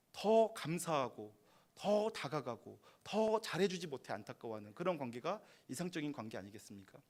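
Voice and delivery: usual speaking voice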